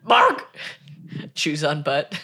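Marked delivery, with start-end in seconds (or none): 0.0-0.6 very high, loud yappy bark. Acted. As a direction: yap it like a tiny dog. Imitating dog barks.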